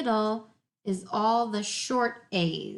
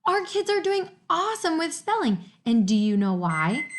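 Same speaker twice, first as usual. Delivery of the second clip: ordinary talk, nothing marked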